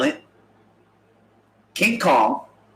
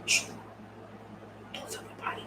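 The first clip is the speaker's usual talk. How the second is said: whisper; 19 dB quieter, faster than usual. Acted. Confiding a secret to audience.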